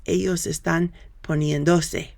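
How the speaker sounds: poniéndose with the stress on "en"; model Spanish pronunciation. Shown as a demonstration. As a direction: say poniéndose as po-NIEN-do-se. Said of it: "that really should be pronounced".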